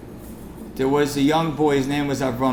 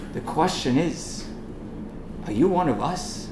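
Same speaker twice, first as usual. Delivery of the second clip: ordinary talk, nothing marked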